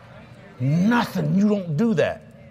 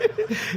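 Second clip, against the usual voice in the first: high laugh; 3 dB quieter than usual. Retold or imitated. Retelling friend's laugh.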